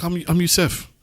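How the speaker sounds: high-pitched Moroccan introduction; imitated Moroccan accent. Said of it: "he's saying this in his accent".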